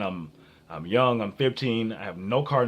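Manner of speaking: usual speaking voice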